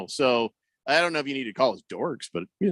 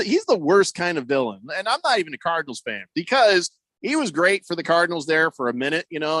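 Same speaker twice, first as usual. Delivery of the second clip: ordinary talk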